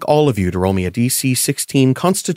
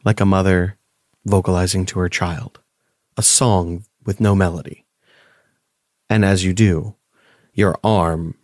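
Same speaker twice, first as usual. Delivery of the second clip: game master narration; 5 semitones lower than usual. A narrator's reading. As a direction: paint the eerie scene gently. GM describes a haunting scene.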